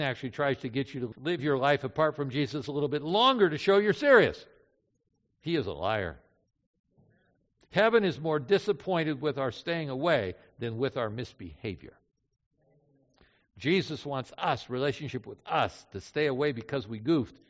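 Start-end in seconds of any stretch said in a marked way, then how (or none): none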